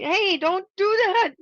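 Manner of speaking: sudden high, loud, slow yell; shouting. Real, not acted. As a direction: call out sharply to stop him. Yelling at Martin on camera.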